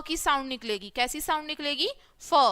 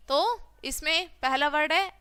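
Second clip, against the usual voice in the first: ordinary talk, nothing marked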